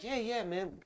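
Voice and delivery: high-pitched imitation of agreeing friends; imitated friends. Group of friends saying who they are, imitated high voice. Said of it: "And they're like".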